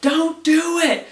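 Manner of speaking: high, strained shout to stop; acting out a frantic scream; imitated desperate yell. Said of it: "And he's yelling".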